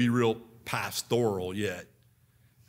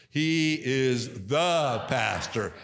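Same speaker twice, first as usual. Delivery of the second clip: "He is the pastor" slow, with "the" given weight; slow stress on the; much slower than usual. Shown as a demonstration. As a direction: draw out "He is the pastor" and lean on "the". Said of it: "let's try it again".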